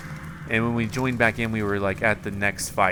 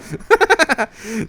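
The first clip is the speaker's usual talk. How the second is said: giggling burst after the yell; high laugh; 12 semitones higher than usual. Real, not acted. Player laughing after another death.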